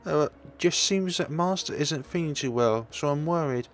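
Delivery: very high, fretful character voice; worried servant. Acted. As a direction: put on a high voice, anxious for the master.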